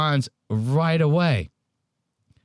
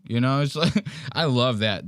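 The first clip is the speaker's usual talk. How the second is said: ordinary talk, nothing marked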